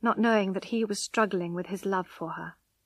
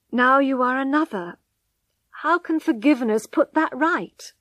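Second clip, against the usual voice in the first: a hint of a stern, louder husband, slowing on the key words; lightly voiced man, firm and raised; stern husband Angel; 4 semitones higher, 8 dB louder than usual.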